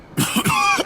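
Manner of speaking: hard wet cough; painful hacking cough. Acted. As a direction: cough up blood after a beating. Beaten Joe coughing blood.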